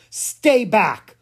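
loud, high command to halt a stranger; boy shouting a stern warning; commanding order. A narrator's reading. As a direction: bark it out to stop her in her tracks. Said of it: "ordered in a commanding voice".

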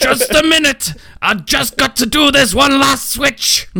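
squeaky shouted villain, hammed up; comic high-pitched baddie; high mock villain voice. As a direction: play the fussy game villain shouting from far off.

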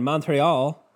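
badly faked French-Canadian accent; half-hearted stab at a Quebec French accent; terrible French accent. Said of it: "That was a terrible accent".

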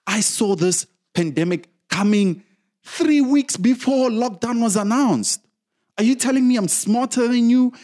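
loud, high-pitched furious rant; pissed off. Real, not acted. Ranting at parliament about the late lockdown, loud and furious. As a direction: shout your frustration at parliament. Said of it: "I'm pissed".

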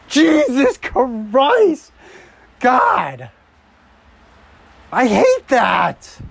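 high, loud, slow shout; furious outburst. Real, not acted. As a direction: explode at the screen. Reacting to a bad play.

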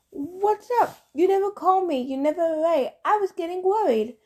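high, bright, cheeky big brother; teasing brother. Acted. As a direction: tease your twin sister. Apollo teasing his sister.

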